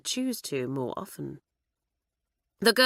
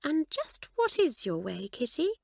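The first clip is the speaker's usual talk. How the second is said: curious; 9 semitones higher, 3 dB quieter than usual. Acted. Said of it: "ask curiously".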